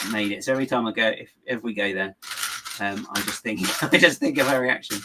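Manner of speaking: ordinary talk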